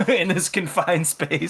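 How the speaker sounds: ordinary talk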